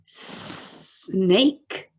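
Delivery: snake said slowly with a long hissed s; drawn-out snake. Shown as a demonstration. Teacher models the first sound for children. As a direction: stretch the s at the start of snake. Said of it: "You say it".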